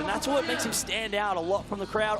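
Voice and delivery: excited live commentary. Real, not acted. Commentator watching Logan land, rising excited play-by-play. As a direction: call the tricks as they happen, astonished.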